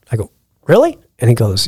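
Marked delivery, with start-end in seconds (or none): 0.7-1.0 surprised. Retold or imitated. Stunned by instant yes.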